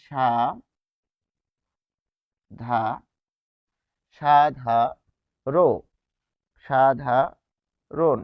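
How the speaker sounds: "সাধারণ" in long separate syllables with pauses; demonstrated pronunciation. Shown as a demonstration. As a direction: build "সাধারণ" slowly, sha, dha, ron. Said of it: "এটি হবে". ("সাধারণ" ভেঙে ভেঙে, শা-ধা-রোন)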